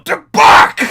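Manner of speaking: sudden explosive yell; imitated yell. Friend yelling into a classroom as a prank, imitated yell. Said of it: "open the door and yell".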